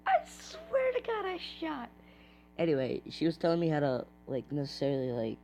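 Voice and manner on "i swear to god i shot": frustrated squeal. Real, not acted. Gamer protests that his shot counted, squealing. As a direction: squeal it in disbelief.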